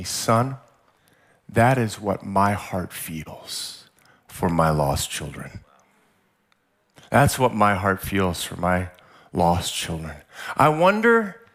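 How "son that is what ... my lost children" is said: low, soft, solemn divine voice; voice of the Spirit. Retold or imitated. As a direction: speak slowly and tenderly as God to a son. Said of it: "the Spirit started speaking to me".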